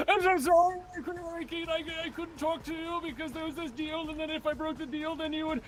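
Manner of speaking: high, wailing fake tears; hammed-up sobbing apology; mock crying apology. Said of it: "I start crying".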